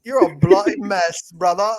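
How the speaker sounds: Cockney insult with a laugh; mock Cockney. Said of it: "you guys are very Cockney".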